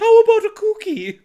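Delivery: put-on high, loud wheedling kid; a child begging for a treat; little boy voice. Voicing the son asking his mom for a cookie, childish.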